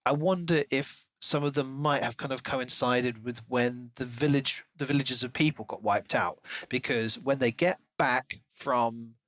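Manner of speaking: normal talking voice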